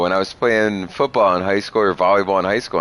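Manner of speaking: usual speaking voice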